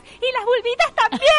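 imitating a little girl's high yell; mock child's squeal, very high and loud; Mora's shout. Mother retelling a girl yelling from behind, high imitated shout. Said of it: "Mora grita por atrás".

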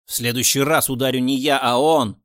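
loud threatening shout; angry threat. A narrator's reading. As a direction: yell the threat. Boss threatens captive.

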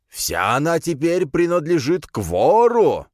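slow, high triumphant proclamation; cult leader. A narrator's reading. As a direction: declare it like a zealot preacher. (протяжное, высокое провозглашение главаря секты)

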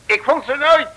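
boastful punchline build. Acted. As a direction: trumpet it like a triumph. Boastful detective delivers punchline. (opschepperig en luid naar de clou)

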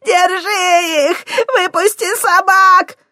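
loud, high, slow screaming crone; shrieking orders. Acted. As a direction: scream the orders after them. Old woman screams orders. (старуха визжит приказы)

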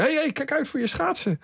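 high, sharp warning call. Retold or imitated. Official yells at the skater to mind his blades, high warning call. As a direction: call him off the concrete in alarm.

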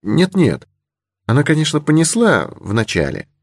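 put-on high, hasty-to-correct girlish voice; a touch flustered, pitched well up; slightly embarrassed. A narrator's reading. Girl admitting the horse did bolt at first, slightly embarrassed. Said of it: "несколько смутилась девушка".

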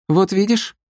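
high, pleased chirp; self-satisfied. A narrator's reading. Sister proved right before her brother, self-satisfied. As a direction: pipe up pleased with yourself. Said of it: "довольно цокнула языком Галка".

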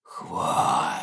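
a raspy, voiceless spat "Хвать!"; the freak spits at its victim; hissing creature. A narrator's reading. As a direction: spit it out like a monster. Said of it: "изрыгнул урод".

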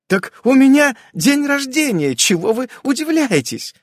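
put-on high, loud exclaiming old aunt. A narrator's reading. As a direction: exclaim it like a bustling hostess. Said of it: "восклицает тётя Рая".

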